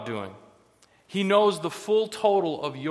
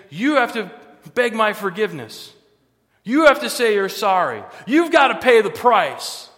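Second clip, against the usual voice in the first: raised, louder preacher's mock demand, building; harsh demand; 5 semitones higher, 7 dB louder than usual. Forgiven sinner grabbing a fellow servant, loudly demanding. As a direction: act out an unforgiving accuser, getting louder.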